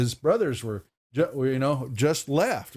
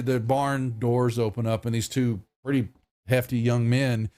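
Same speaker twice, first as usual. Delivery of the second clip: normal talking voice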